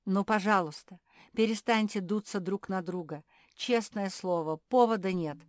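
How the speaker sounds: urgent peacemaker's plea; pleading. A narrator's reading. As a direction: reason with them imploringly. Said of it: "взмолился Филипп".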